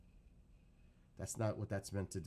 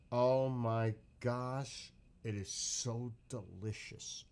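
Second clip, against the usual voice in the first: slow savoring; much slower than usual. Real, not acted.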